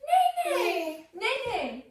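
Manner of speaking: high, playful mock neigh, falling away; horse neigh. Teacher answering her toddler's horse question, playful high neighing. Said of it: "What does the horse say?".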